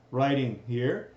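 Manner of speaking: ordinary talk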